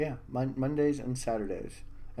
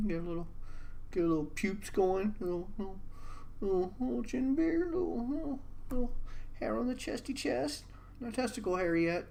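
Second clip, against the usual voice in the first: high squeaky voice; 10 semitones higher, 6 dB quieter than usual. Pretend. Squeaky pubescent voice bit.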